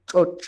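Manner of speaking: choch, clipped ch-o-ch; correct choch. Shown as a demonstration. Teacher to the class modelling the word choch. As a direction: say choch with a full ch sound, not che. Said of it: "No vamos a decir che".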